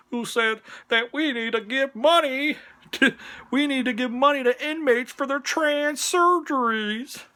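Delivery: high-pitched mock whine; mock them in a silly high voice; mocking put-on voice. Mocking put-on voice about politics.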